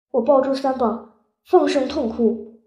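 ordinary delivery, nothing marked

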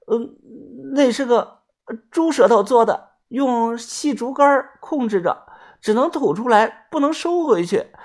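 put-on high, miserable young woman's voice; a caught swindler girl begging; pleading girl. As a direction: wheedle them to let you off.

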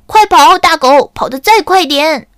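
high, loud shout urging on; driving shout. Acted. Camelido shouts to the hound pulling the pan. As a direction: yell like a charioteer. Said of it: "吆喝着前面奔跑的大猎狗".

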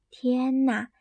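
usual speaking voice

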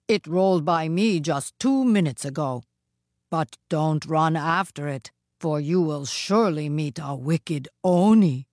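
stern statue giving a warning; a touch of solemn severity. A narrator's reading. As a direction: warn firmly as Jizo. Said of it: "said a very stern Jizo".

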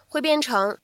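usual speaking voice

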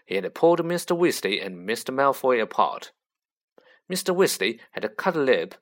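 plain reading voice

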